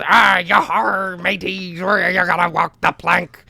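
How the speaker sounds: slow, drawn-out pirate voice; lightly hammed-up pirate calling mateys; pirate ride operator. Ride guy greeting riders in a slow pirate voice. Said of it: "And the guy goes".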